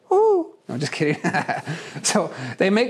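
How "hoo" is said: high falsetto hoo hoo hoots; playful high owl hooting. Retold or imitated. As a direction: hoot like an owl at night. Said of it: "I've been practicing that".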